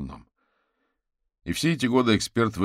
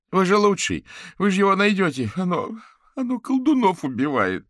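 high, loud frantic appeal; desperate pleading; 9 dB louder than usual. Acted. Fetisov begs Averin to find the killer, hysterical pleading. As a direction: plead as if your life depends on it.

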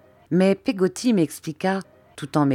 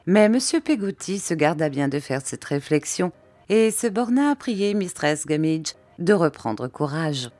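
usual speaking voice

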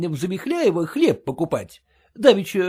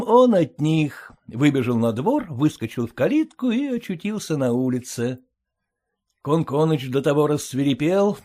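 plain reading voice

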